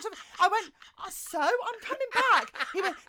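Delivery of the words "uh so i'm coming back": laughing. Retold or imitated. Dismissive reply, laughing.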